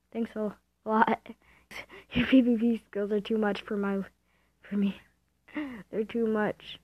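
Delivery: normal talking voice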